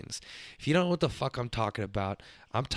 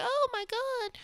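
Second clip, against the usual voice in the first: put-on squeaky high shocked little girl; little cousin gasp; slower than usual. Young cousin reacting to his reply, very high squeaky shock. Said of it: "she's like".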